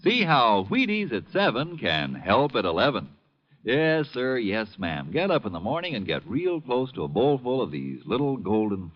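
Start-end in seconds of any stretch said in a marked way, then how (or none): none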